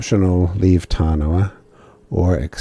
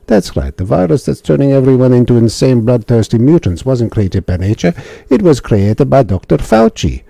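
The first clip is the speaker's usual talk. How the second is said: higher and louder silly villain-explaining voice; officer revealing the plot in a silly voice; hammed-up officer voice; 6 semitones higher, 5 dB louder than usual. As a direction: declaim it like a ham officer. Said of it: "Different silly voice".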